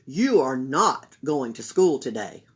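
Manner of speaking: low, loud firm mother; mother keeping her daughter home; stern mother. Acted. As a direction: lay down the law. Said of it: "her mother ordered".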